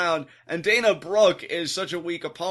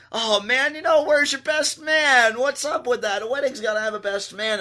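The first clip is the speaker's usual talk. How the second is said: all smiles; 9 semitones higher, 5 dB louder than usual. Retold or imitated. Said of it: "it's all smiles like".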